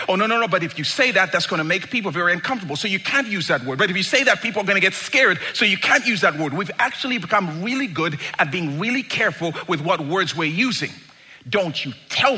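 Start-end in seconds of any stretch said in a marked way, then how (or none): 0.1-6.5 fast, piled-up protests; hurried mock warning; rapid mock objections. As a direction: rattle it off like a fussy word censor. Acted escalating objections, rapid.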